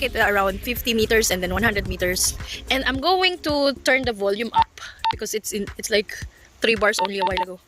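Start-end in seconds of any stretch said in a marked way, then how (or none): none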